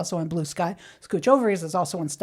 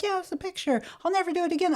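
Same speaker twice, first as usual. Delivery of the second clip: high, soft apologetic little voice; a flustered elderly mother, much higher and softer; sorry mom voice; 10 semitones higher, 5 dB quieter, faster than usual. Retold or imitated. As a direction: mom sheepishly apologizing to her worried son, high and soft. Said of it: "She's like".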